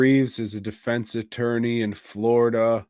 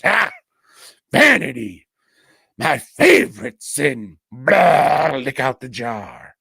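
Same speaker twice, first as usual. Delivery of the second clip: Al Pacino devil impression; 3 semitones higher, 8 dB louder than usual. Al Pacino as the devil gloating, loud hammy impression. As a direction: savour the sin line like Pacino. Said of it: "turns into Al Pacino and goes".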